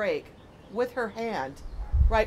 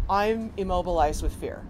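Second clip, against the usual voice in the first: ordinary talk, nothing marked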